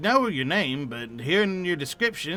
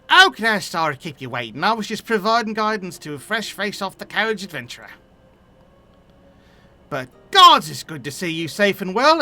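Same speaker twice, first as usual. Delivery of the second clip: put-on an NPC in a muddled Cockney accent; lightly done Cockney-ish accent, not quite consistent; messy Cockney accent; 3 semitones higher than usual. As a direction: lean into Cockney, a bit mixed up. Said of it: "what is this accent? ... mix between Boshin and Cockney".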